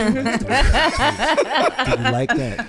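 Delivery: high, laughing mock narrator; laughing mock narration. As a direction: crack up while narrating, pitch way up. Mock narrator act breaking into laughter.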